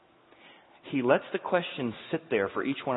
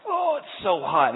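sweltering Jonah; 5 semitones higher, slower than usual. Acted. Jonah voiced complaining of heat.

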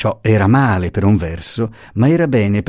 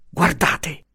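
high urgent alert, quieter; 8 semitones higher, 4 dB quieter than usual. Acted. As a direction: point it out in alarm. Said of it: "l'avvocato fece".

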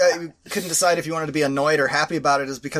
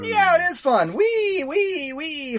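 mock happy kid; 12 semitones higher, much slower than usual. Imitating kid dragged on carpet.

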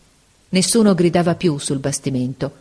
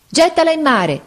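much higher, louder command; sailors shout; 11 semitones higher, 4 dB louder than usual. A narrator's reading. Sailors shout to throw her in, commanding. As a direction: hurl the order. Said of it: "gridarono i marinai".

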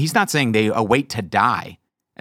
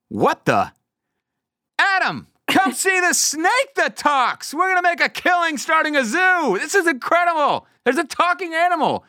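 amazed Eve. Acted. Eve amazed at talking snake.

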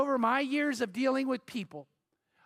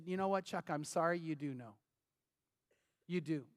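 a retold, much softer and lower apology; tearful apology; 6 semitones lower, 9 dB quieter than usual. Woman apologizing to Chuck through tears, hushed. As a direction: quietly give in, a touch of tears. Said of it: "she started crying".

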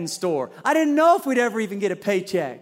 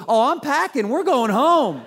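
higher, louder, brisk cheer; wife cheerfully deciding, higher and louder; bright decisive wife; 5 semitones higher, 6 dB louder, slower than usual. Retold or imitated. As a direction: chirp it like it's settled.

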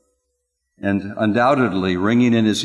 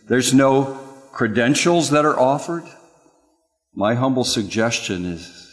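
usual speaking voice

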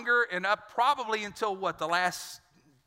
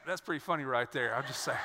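laughing aside; 8 semitones lower, 6 dB quieter, faster than usual. Real, not acted. Aside about his wife's silence.

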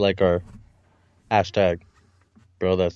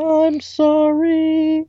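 mock falsetto apology; 14 semitones higher, much slower than usual.

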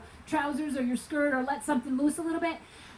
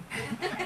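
short laugh; 4 semitones higher, 6 dB quieter than usual. Real, not acted. Laugh after the story.

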